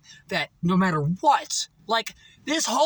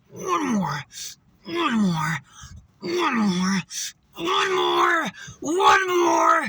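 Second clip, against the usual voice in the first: shouted rep count, rising; pushing through the last rep, shouting; yelled gym count; much slower than usual. Retold or imitated. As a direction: yell "one more" like a spotter. Gym rep encouragement yelling.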